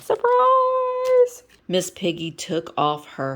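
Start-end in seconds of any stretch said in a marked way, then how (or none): 0.0-1.4 excited. Real, not acted. Reader's exclamation.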